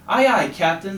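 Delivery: high, loud chirpy chorus; little toads answering smartly; eager crew. A narrator's reading. Toadlets answer the captain, high loud eager chorus. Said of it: "the toadlets agreed".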